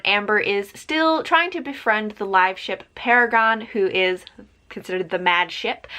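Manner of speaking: ordinary talk, nothing marked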